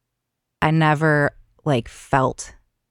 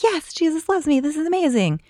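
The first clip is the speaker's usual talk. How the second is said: much higher, sing-song fake delight; high-pitched hammed-up rapture; mock gushing joy; 14 semitones higher than usual. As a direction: parody an overjoyed believer, voice way up high. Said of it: "I'm not like".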